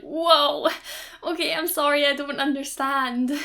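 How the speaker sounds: flustered surprise. Retold or imitated. Fringe actor caught out by real Russians, startled and apologetic. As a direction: thrown by a stranger suddenly speaking Russian. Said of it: "We'd be like".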